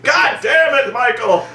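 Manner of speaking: high yell dropping on the name; mock fed-up scolding shout; exasperated director. Director scolding the trolling actor, exasperated yell.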